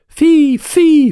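very high, loud shrieking owl; a dying spirit owl screeching; the owl shrieking as it sinks into the mud; owl cry. A narrator's reading. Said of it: "faisait-elle".

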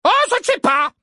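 high, loud and forceful; booming voice. A narrator's reading. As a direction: boom it out across the court. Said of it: "de sa voix tonitruante".

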